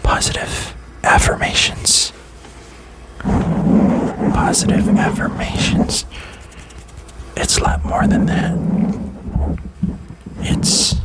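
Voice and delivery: soft ASMR whisper; breathy close-mic whisper; whispered pep talk. Real, not acted.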